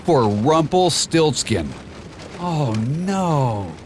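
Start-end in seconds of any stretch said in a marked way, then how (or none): 2.4-3.7 sadly. A narrator's reading. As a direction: let the hope drain out. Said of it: "he said sadly".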